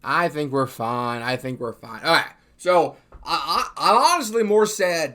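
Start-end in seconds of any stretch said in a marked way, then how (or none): 2.0-5.1 loud, wound-up restart; overexcited near-yell; too loud. Real, not acted. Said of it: "stop yelling".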